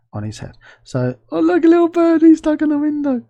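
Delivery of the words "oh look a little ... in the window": delighted squeal. Real, not acted. Delighted spotting a bird in scene.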